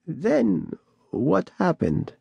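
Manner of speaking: put-on creaky old voice. A narrator's reading. Bat Lady asks.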